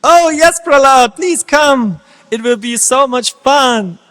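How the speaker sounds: put-on a child begging a friend to play; a schoolboy calling Prahlad out to play; eager child.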